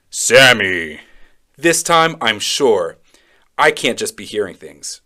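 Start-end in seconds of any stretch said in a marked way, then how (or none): 0.1-1.0 low, loud, drawn-out deep call. Acted. Deep voice calls the child again, low and loud. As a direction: boom the name low and deep. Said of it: "hear this deep voice say my name".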